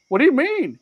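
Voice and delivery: high-pitched mock bafflement; baffled protest. Stranger to the scolding shopper, baffled. Voicing the stranger's puzzled look.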